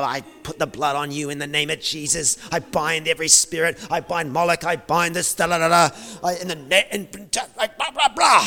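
lightly hammed-up spiritual warfare; mock binding prayer. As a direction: parody a frantic deliverance prayer. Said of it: "I would have got up".

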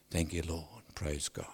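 soft breathy praise whisper. Real, not acted. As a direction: whisper it under your breath. Whispered close of prayer.

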